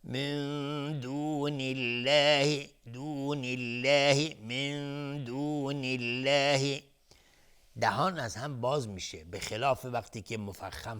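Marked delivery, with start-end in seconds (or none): 0.0-6.8 الله in من دون الله light and slow, repeated; a slow, light الله in a chanted demo; thin Allah. Shown as a demonstration. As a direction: open the mouth and say الله thin three times.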